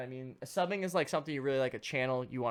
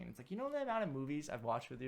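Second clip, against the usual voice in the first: ordinary delivery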